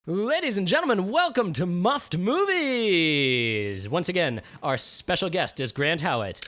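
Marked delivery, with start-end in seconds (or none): 0.1-3.9 booming show host; announcer voice. Acted. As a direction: announce it like a showman.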